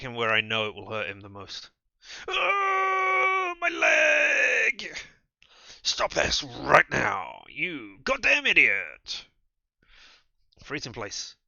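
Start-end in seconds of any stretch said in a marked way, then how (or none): 2.3-5.0 very high, loud shriek of pain. Acted. Kicked man shrieking in pain. 5.8-9.2 loud, very slow furious command. Acted. Kadir furious at Julia for the kick. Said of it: "never heard him use that tone".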